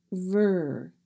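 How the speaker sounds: "ver" said slowly with no vowel, just v and r. Shown as a demonstration. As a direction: drag out "ver" as v plus r sound. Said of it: "We do not say a vowel sound in that syllable".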